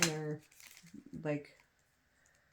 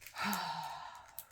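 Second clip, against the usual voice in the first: sigh. Real, not acted. Sighing over spilled glitter.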